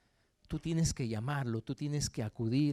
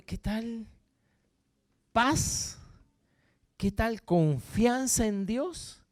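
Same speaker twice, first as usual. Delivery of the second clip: high, loud, stretched-out words; impassioned preaching; 8 semitones higher, 6 dB louder, slower than usual. Acted. Preacher urging trust in God before chasing, high and slow. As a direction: lift the voice and draw out the appeal.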